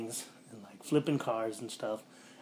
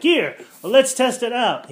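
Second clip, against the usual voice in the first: higher, louder eager call; mock eager; 12 semitones higher, 13 dB louder than usual. Ben wants to test the swap, mock eager call. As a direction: urge them on with gusto.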